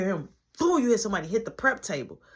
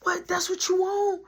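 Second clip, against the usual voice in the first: much higher, pushy taunt; mock challenge; 11 semitones higher than usual. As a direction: throw it out like picking a fight. Christopher challenging, quoted.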